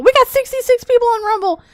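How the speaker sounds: thrilled squeal over the viewer count; high, loud burst of joy; excited. Real, not acted. Streamer cheering at her Rumble viewer count, excited.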